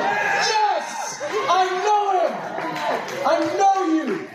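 preacher imagining seeing Jesus, high joyful shout; Buddy the Elf shout. Pretend. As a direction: cry out like an overjoyed elf. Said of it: "I'm gonna be like Buddy the Elf".

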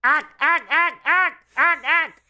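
high squawking alien ack; alien impression. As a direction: chirp it like a Martian invader. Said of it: "can I do the alien impression".

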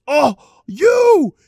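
put-on high, loud accented shriek of recognition; mock scream; imitated screaming. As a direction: yell it like an overexcited fan spotting someone. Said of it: "He starts screaming".

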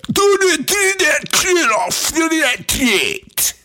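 high, loud mock Scottish shriek; wildly hammed-up Scottish screech; thick Scottish accent. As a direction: drag out garbled Scottish words at a high-pitched shout. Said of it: "this is the way this chick sounded".

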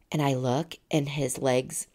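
ordinary delivery

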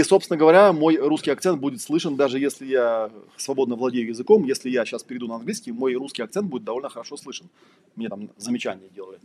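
ordinary delivery

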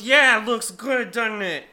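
put-on high, loud proud drunk; imitating drunk man. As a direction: fish for a compliment, slurring. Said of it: "And he goes".

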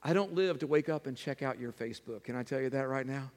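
usual speaking voice

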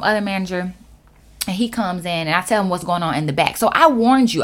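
normal talking voice

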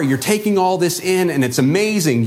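ordinary talk, nothing marked

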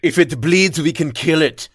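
put-on Arnold accent. Buzzing in again, loud hammed-up Austrian accent. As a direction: deliver it like Arnold facing the Predator. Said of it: "saying anything in an Arnold accent".

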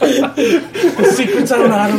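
high, loud words through laughter; laughing. Real, not acted. Teasing the game master over a slip, laughing loudly. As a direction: crack up while saying it.

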